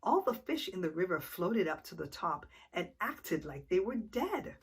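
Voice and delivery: plain reading voice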